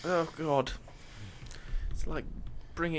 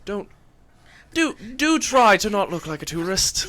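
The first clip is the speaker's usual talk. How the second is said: loud, prim reprimand; haughty scolding; 6 semitones higher, 8 dB louder than usual. Acted. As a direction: scold them like a snooty aristocrat. Noble chides party loudly.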